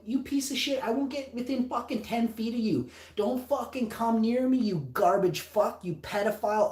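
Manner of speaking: high-pitched, heated tirade; angry telling-off. Retold or imitated. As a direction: retell chewing him out. Retold telling-off in hallway.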